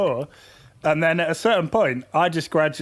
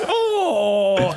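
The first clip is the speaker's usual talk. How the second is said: long mocking "oh"; drawn-out, held mocking noise; Andy; 3 semitones higher than usual. Imitating a friend who mocks people, drawn-out mocking noise. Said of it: "he'd make, like, a, that noise".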